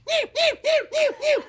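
very high squeaky meows in a fox's voice; mock fox cries, high and tiny; fennec fox meowing. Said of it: "run around in circles and go".

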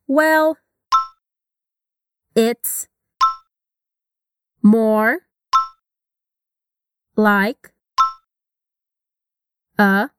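each word alone, long pauses between. Shown as a demonstration. Teacher breaking the phrase apart word by word. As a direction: chop it into separate words. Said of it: "linking sound".

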